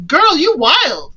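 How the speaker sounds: slow teasing. Real, not acted. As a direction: drag it out in disbelief. Teasing the character.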